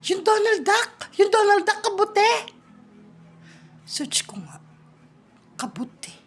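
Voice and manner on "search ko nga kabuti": soft whispered aside, breath only. Real, not acted. Child quietly looking up the answer, whispering. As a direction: mutter it to yourself under your breath.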